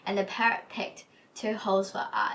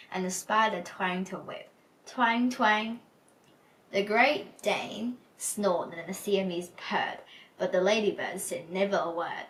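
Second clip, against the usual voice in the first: plain reading voice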